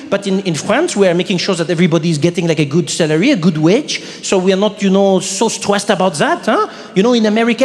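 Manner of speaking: put-on French accent, explaining patiently; lightly imitated Frenchwoman's accent; French woman. Frenchwoman defending her countrymen to comedian, French accent.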